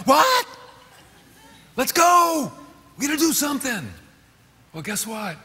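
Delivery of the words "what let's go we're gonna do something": high, loud startled cry; startled and suddenly urgent; alarmed jump into action. Acted. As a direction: leap up in alarm, ready to move. Jolted into action by fire alarm.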